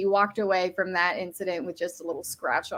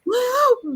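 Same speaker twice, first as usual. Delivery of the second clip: loud, high-pitched gasp; shocked gasp; 5 dB louder than usual. Real, not acted. Reacting to a friend's teasing dig, shocked gasp. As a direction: gasp sharply at the jab.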